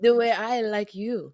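deliberate Botswanan accent, each word set apart; careful audition-style Botswanan accent. Shown as a demonstration. Actress repeats her audition answer, Botswanan accent. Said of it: "why my voice got so deep".

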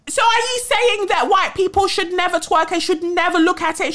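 high-pitched mocking imitation of a dim critic; mock idiotic objector. Host imitating people who twist her point, high idiotic voice. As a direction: put on a high, silly voice of indignant misunderstanding. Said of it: "idiotic people will start going".

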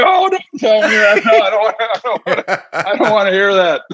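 mock-horrified, high and laughing; laughing dismay. Broadcaster hearing his name is trending, laughing protest. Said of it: "And I was like".

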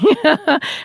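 high burst of laughter; bright laugh. Real, not acted. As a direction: laugh it off lightly. Laughs at own joke.

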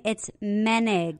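Menig said slowly, MEN-ig, stress on first syllable; name pronunciation. Shown as a demonstration. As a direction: spell out Menig clearly and slowly. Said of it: "For those who are wondering".

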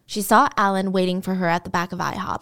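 usual speaking voice

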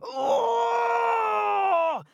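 high, loud cry of pain; pained giant howl. A narrator's reading. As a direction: yelp at the second tooth. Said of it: "Again he hollered".

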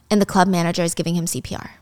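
plain reading voice

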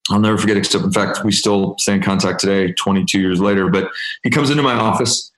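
ordinary delivery

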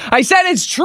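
high laughing shout. Real, not acted. Co-host repeating to host who missed it, laughing shout.

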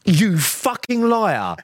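high, drawn-out mock outrage; retold accusation. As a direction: replay the accusation slowly, pitched right up.

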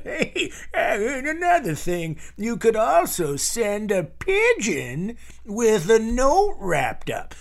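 high, slowed, laughing goofball voice; goofy silly voice. Pretend. Comic telling listeners to send a pigeon, high goofy voice. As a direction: ham it up like a cartoon nag with a giggle. Joking about ways to send questions.

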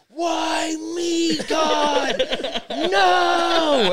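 high, loud wail at the sky; over-the-top howl of despair; despairing shout. Retold or imitated. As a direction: cry out to God in hammed-up anguish. Raging at the storm.

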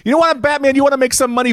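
very high-pitched comic pleading; exasperated rant. Acted. Resident pleads with hero about the gloom, high exasperated rant. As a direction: argue the obvious way up in pitch.

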